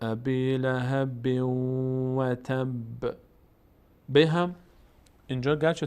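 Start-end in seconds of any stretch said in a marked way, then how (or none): 0.0-3.1 slow, drawn-out tajwid recitation; Quran recitation. Shown as a demonstration. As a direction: chant each word slowly.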